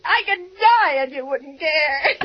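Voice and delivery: slow, tearful and wailing; crying accusation. Acted. Lonely invalid sobbing accusation at the operator. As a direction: sob it out accusingly.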